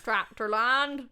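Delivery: slow, laughing West Country "tractor land"; mock Somerset accent. Teasing her podcast co-host, laughing in mock Somerset accent. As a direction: ham up a Somerset farmer accent through a laugh. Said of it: "Not the best accent in the world".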